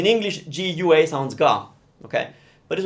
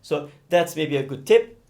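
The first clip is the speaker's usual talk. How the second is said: ordinary talk, nothing marked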